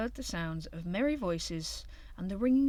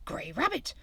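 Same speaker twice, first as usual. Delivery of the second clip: excited shout; 9 semitones higher, 7 dB louder than usual. A narrator's reading. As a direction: whisper then high loud shout. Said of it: "shouted Hare".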